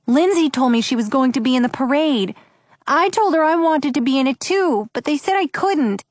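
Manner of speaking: put-on small boy explaining, a touch upset; quivering little boy. A narrator's reading. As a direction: plead your case as a troubled young boy. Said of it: "His voice quivered a little".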